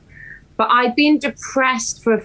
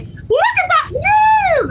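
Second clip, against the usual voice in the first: much higher, slow, joyful yell; ecstatic shout; much slower than usual. Retold or imitated. Driving alone, shouting with joy at the view. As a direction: shout your delight at the view. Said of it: "talking to myself".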